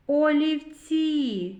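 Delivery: олівці slowly stretched, long final і; drawn-out sounding-out. Shown as a demonstration. Teacher leading children to the last sound, slowly drawn out. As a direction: hold the last vowel of олівці. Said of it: "звуковий аналіз слова олівці".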